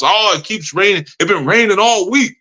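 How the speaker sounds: imitating a fed-up complainer; complaining. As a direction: whine about it, pitch rising as it goes. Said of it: "you still complaining".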